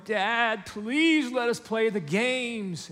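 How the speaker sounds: put-on high, drawn-out begging child; imitated kid pleading, stretched out slowly. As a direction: beg dad like a whining kid. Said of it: "your kids are going".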